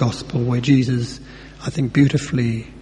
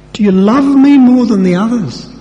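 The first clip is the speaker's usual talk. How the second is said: high, loud, overdone challenge; mock sarcastic; 9 semitones higher, 9 dB louder than usual. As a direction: fling the question up high like a jibe. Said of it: "He wasn't being sarcastic".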